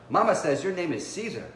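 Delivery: put-on a young boy brightening up; excited boy nephew. A narrator's reading. Nephew eagerly tells his uncle what Mama said, excited.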